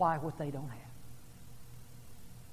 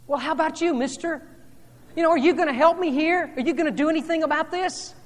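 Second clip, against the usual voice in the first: higher, louder imagined plea; boy silently begging the stranger for help; pleading boy's look; 11 semitones higher, 11 dB louder than usual. Retold or imitated. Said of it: "His expression said to me".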